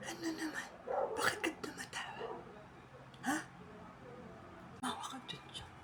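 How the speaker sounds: breathy side whisper, barely audible. Real, not acted. Whispering to Junjun during filming. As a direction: sneak a question under the scene.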